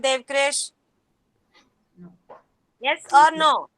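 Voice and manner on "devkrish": ordinary talk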